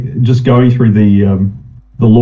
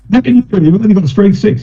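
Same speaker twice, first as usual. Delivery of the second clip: high, disbelieving question; can't believe the weakling tries; incredulous player; 9 semitones higher than usual. Retold or imitated. Player mocks scrawny elf.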